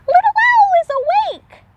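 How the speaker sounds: very high, loud piglet squeal; high squeaky piglet voice calling hello. A narrator's reading. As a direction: squeal it like an excited baby pig. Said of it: "squealed another".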